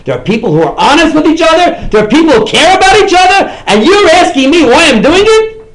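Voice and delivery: loud, high emotional plea; rising fervent plea; impassioned outburst. Retold or imitated. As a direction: pour out the conviction. Impassioned quoted speech.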